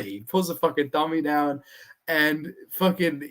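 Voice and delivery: normal talking voice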